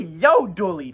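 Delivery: ordinary delivery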